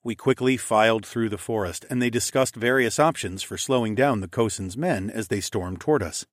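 usual speaking voice

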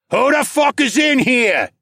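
high, loud, drawn-out bellow; irate farmer yelling into the barn; angry farmer shout. A narrator's reading. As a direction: shout slowly like a furious farmer confronting intruders. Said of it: "the angry farmer shouted".

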